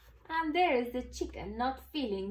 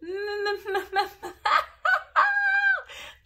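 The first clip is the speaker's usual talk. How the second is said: big laugh; 10 semitones higher, 9 dB louder than usual. A narrator's reading. All animals laugh.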